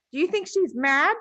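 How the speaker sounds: wide-eyed, asking a friend; curious schoolgirl. Acted. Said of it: "Lavender asked".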